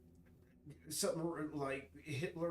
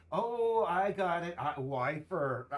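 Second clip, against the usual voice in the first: sudden realization; 5 semitones higher, 9 dB louder, slower than usual. Real, not acted. Suddenly getting the game's name.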